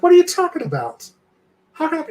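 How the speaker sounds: very high, incredulous protest; retold disbelief. Told by husband of sleeping on stream, incredulous. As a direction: squeak out a startled protest.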